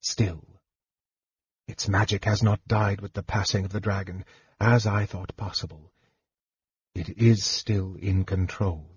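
hushed, measured mage, sure of his knowledge; soft-spoken young wizard; softly voiced mage. A narrator's reading. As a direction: explain it quietly, with weighty pauses. Young mage explains the orb.

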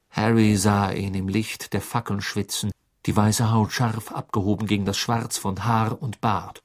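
ordinary delivery

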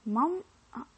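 odd kiddie voice; weird kid voice. Acted. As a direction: play a child asking with a silly sing-song lilt. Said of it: "weird voice".

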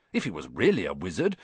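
usual speaking voice

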